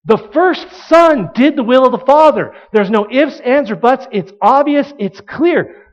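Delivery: forceful answer, punching the key words; emphatic. Acted. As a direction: declare it with no room for doubt. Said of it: "They say emphatically".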